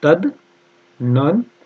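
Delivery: very slow तदनंतर, tad... nan... tar; long pauses between the parts; syllable-by-syllable dictation. Shown as a demonstration. Teacher dictating spelling slowly.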